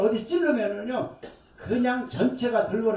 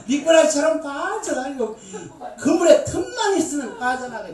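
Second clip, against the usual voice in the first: louder, higher, laughing through it; laughing teasing; 6 semitones higher, 6 dB louder than usual. Real, not acted. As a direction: tease while laughing.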